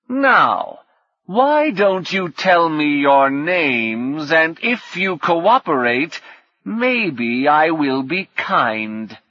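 slow, high menacing ruler's voice; menacing god-king. Acted. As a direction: draw it out like a cruel captor toying with prisoners. False Atasco threatens captured guests.

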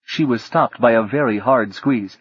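ordinary talk

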